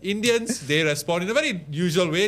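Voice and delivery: real chuckle on the words; laughing. Real, not acted. Guest keeps laughing at own joke.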